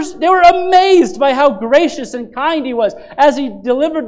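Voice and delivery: loud, rising sermon delivery, pressing each line home; full-force preaching. Acted. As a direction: proclaim it to the congregation.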